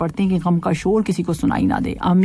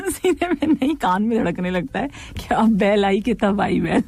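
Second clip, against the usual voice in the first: usual speaking voice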